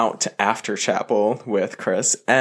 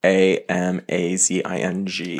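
each letter spelled out slowly; spelled out in capital letters; 4 semitones lower than usual. Shown as a demonstration. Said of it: "I'll spell this out in capital letters".

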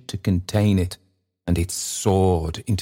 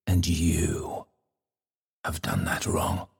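grave accusation; 7 dB quieter than usual. Acted. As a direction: sink to a near-whisper to accuse. Bell's final grave accusation.

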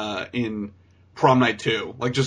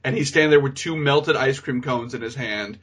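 usual speaking voice

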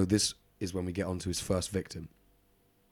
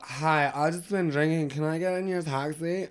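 put-on drunk girl voice; 7 semitones higher than usual. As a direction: play the tipsy customer slurring a request. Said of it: "I just picture this girl like".

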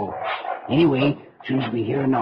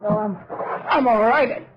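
hurt, shaken; 10 semitones higher than usual. Acted. Has just fallen through the floor.

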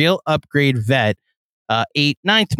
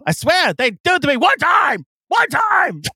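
high shouting radio rant; Mad Dog rant; 12 semitones higher than usual. Retold or imitated. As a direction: yell it like a furious radio host. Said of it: "him ranting about the Giants".

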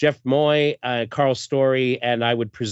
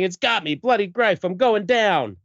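higher-pitched, hammed-up distress; acted panic; 7 semitones higher than usual. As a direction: play a comic-book hero going under. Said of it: "Joe's saying".

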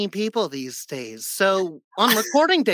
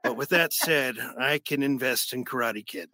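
low, halting closeted old-man impression; Wilford Brimley; 6 semitones lower than usual. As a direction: grumble a reluctant confession down low. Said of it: "closeted homosexual that hates himself".

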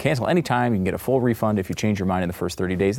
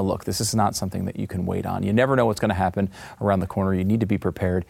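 usual speaking voice

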